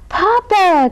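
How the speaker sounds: high, drawn-out joyful call; crying out. A narrator's reading. Hugh Boy seeing his father at the harbor, crying out. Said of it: "cried Hugh Boy".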